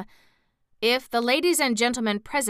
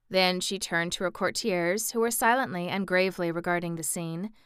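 ordinary delivery

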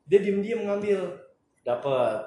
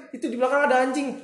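fast panicked; 8 semitones higher, faster than usual. Retold or imitated. Panicked friend explaining, fleeing dog.

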